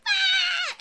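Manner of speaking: high shrill goblin cry; high goblin shriek; goblin scream. Acted. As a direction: let out a high goblin yelp.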